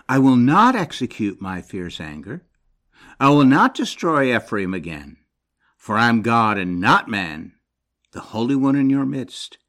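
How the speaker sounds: firm, loud declaration of the verse; scripture reading. Acted. Preacher proclaiming God's promise to the congregation, firm. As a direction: declare God's vow with force.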